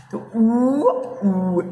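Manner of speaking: long pushed "ऊ"; demonstrating vowel sound. Shown as a demonstration. Teacher shows students how it happens, demonstrating "ऊ". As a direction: stretch out "ऊ" to show how it forms. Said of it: "ऐसा हो रहा है".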